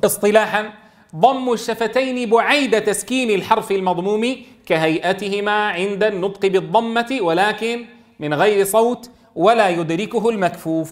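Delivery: normal talking voice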